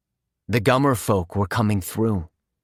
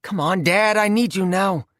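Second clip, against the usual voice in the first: put-on higher girl voice; 7 semitones higher than usual. A narrator's reading. Quinn pleading with lighter.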